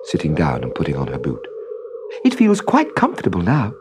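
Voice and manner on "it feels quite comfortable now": put-on little girl voice. A narrator's reading. Trudy voiced.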